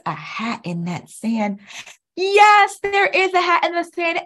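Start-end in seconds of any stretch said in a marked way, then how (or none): 2.2-4.2 loud, high yes; excited. Acted. Teacher tells children the hat is there, excited. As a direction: cheer the confirmation. Confirming correct answer.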